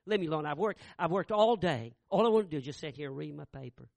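loud, fast, fed-up brush-off; loud exhausted snap at his son; irritated father. Retold or imitated. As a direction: push the kid away after a long day. Tired father snaps at son.

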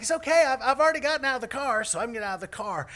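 high, loud, rushed reassurance; hammed-up shouted reply, easing off at the end; yelling back. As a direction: call back quickly across a distance.

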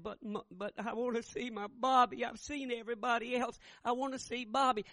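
put-on high, insistent begging for her boy; pleading mother. Feverish mother begging her children to see Bobby, high and insistent. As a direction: beg to see your son. Dying mother asks for son.

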